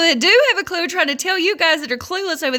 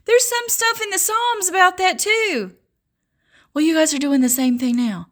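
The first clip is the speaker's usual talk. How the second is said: ordinary talk, nothing marked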